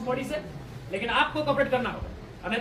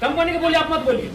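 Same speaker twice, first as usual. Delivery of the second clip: loud, forceful demand; loud protest; 3 semitones higher, 7 dB louder than usual. Real, not acted. Protest leader to officials about police guarding the company, loud and forceful.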